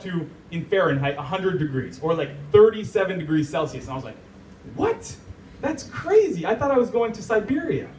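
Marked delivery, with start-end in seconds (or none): 4.8-7.9 high-pitched mock disbelief; comically incredulous retelling, voice up high; acted astonishment. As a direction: react as if stunned, pitch leaping up. Retells his shock at the temperature.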